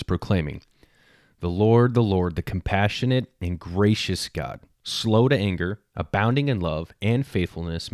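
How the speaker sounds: scripture reading. Acted. A dad reading Exodus aloud to other fathers, proclaiming. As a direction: proclaim the verse to the listeners.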